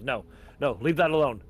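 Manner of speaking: sudden alarmed protest; urgent objection. Acted. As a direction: object urgently, building in volume. Troopers head to his back room.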